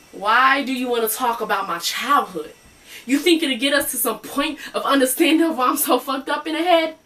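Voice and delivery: acted rising anger at a doctor; indignant challenge. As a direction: challenge the therapist, pitch climbing to the end. Acted patient challenges her therapist.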